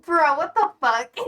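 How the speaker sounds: usual speaking voice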